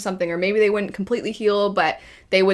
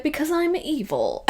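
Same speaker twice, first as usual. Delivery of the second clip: high, softly mocking cartoon villain; 7 semitones higher, 4 dB quieter than usual. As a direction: lightly parody a villain's simplistic logic. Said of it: "it was basically like".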